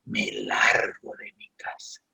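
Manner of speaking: whispered, unvoiced; teen whispering a plan to leave home; whispered declaration. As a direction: breathe it out like a secret vow. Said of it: "hasta que dijimos".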